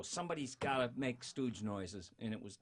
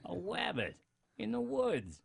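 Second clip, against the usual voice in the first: a hint of higher, wonder-struck Fudd; childlike Elmer; 9 semitones higher than usual. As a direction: say it like a delighted little kid. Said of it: "he was like a little child".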